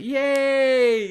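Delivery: put-on high, loud kid's yay; kid cheering. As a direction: squeal with pure joy. Kid overjoyed, retold.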